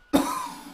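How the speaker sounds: choking cough. Acted. Choking mid-message.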